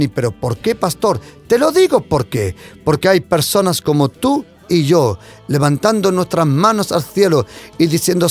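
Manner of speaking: usual speaking voice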